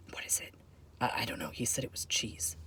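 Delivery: hushed, baffled whispering. Acted. Agents puzzling over a strange bag, whispering. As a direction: confer secretly in a very soft whisper. Agents confer behind his back.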